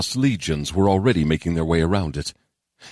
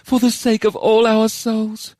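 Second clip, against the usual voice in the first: high, slow, wistful voice; solemn wistfulness in a high character voice; 4 dB louder, slower than usual. A narrator's reading. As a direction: stretch it out high, with longing. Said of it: "Charon answered with a wistful smile".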